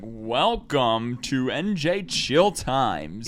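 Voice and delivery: slow drawn-out radio host voice. Retold or imitated. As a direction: announce it like a DJ. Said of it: "starts like a radio host".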